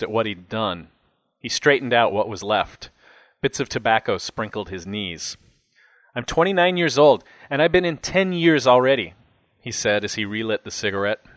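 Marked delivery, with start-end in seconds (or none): 6.1-9.1 higher, emphatic complaint; indignant, put-upon inmate; aggrieved cellmate. A narrator's reading. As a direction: play a jailbird airing his grievance.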